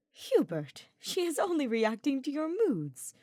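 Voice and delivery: chiding, rising sharply on the second phrase; a gentle scold with a lifted pitch; disapproving. A narrator's reading. Ferdinand chiding Hubert about the mare, disapproving. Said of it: "with a tone and look of disapproval".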